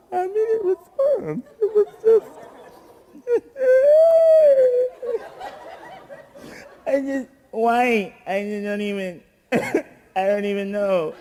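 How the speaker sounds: high, cracking laugh-sob; mock laugh-crying. Comic reliving the thrown flowers, laughing through mock sobs. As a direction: pretend to break down laughing.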